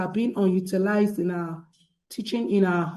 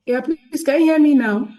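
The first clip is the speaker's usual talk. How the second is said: raised voice, projecting to mic; louder; 6 semitones higher, 8 dB louder than usual. Real, not acted. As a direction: speak up clearly so the room hears. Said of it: "Better, better".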